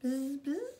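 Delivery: squeaky little bzz; baby bird trying to buzz; high buzzing. Acted. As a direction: buzz like a tiny bee.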